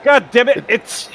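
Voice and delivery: frustrated outburst. Real, not acted. Fuel not going in during pitstop.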